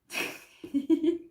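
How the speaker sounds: short happy giggle; real laugh. Real, not acted. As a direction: laugh through a smile you can't hold back. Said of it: "I gotta stop smiling now".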